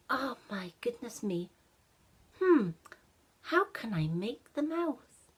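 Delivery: put-on a child working out a problem, then a bright idea; puzzled boy Ashton. Ashton thinks aloud about the mouth.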